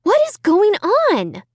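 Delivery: high, slow, alarmed; bewildered. A narrator's reading. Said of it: "I asked out loud".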